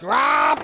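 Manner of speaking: sudden high, loud "wah"; playful noise-making. Pretend. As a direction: blurt a silly yelped sound effect. Repeats automatic silly noise.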